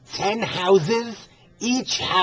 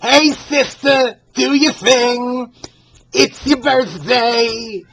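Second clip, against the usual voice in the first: loud, higher cheer for a fan; shout out; 7 semitones higher, 6 dB louder, much slower than usual. Acted. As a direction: hype her up like a party cheer. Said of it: "So I'm shouting out to you".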